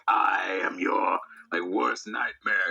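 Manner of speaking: low, slow menacing demon; demon impression. Demon threatening the chit-chatting exorcist, low and slow. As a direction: drag it out low like a movie demon. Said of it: "the thing is, like".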